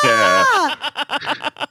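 high laughing shriek; laughing scream. Real, not acted. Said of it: "just me screaming".

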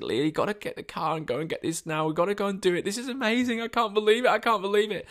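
high, breathless disbelief; excited urging. Retold or imitated. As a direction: hurry her, beside yourself with joy. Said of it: "And I was so excited".

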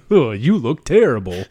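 put-on loud drunken exclamation; town drunk. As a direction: blurt it out like a boozy old man. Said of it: "a town drunk comes out".

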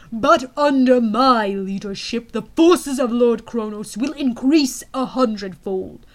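slow, loud boasting villain; ranting dictator speech. Acted. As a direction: proclaim your power like a tyrant. General's voice boasting.

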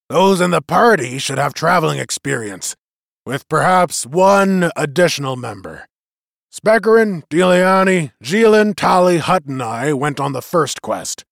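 put-on rally the crowd like an old war chief; leader's address. A narrator's reading.

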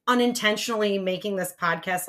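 ordinary talk, nothing marked

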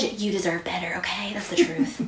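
soft unvoiced whisper. Real, not acted. Host whispering to listeners, tender whisper. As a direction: whisper it like a secret truth.

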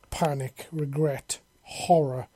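ordinary delivery

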